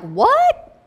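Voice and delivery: high, loud incredulous What?; a shocked, squeaking What? with a rising tune. Retold or imitated. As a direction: fire "What?" up high in disbelief. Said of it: "let's put some emphasis on there".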